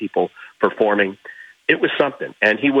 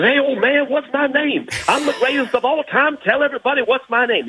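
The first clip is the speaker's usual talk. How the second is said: high, boastful Ali impression; Muhammad Ali. Storyteller voicing Ali boasting to an old man, high and proud. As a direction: crow it like the champ, much higher.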